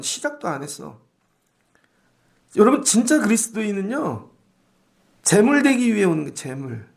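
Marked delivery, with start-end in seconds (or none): none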